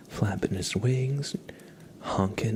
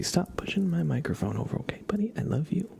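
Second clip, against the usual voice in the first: pet talk; 9 semitones higher, faster than usual. Real, not acted. Telling cat off fondly.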